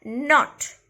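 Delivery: "not" set apart, loud and emphatic; stress on not. Shown as a demonstration. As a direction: land firmly on "not" after a pause. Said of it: "putting a stress on the word".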